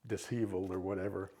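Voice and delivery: ordinary delivery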